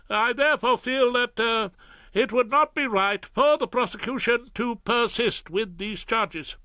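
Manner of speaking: high, anxious prosecutor; apologetic Ballard. Acted. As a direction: admit defeat uneasily. Ballard voiced.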